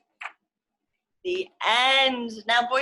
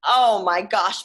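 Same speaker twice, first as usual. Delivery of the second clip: plain reading voice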